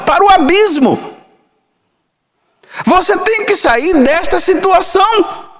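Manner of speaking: fervent high-pitched preaching; preaching at full force. Acted. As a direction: preach it hard, rising to a shout.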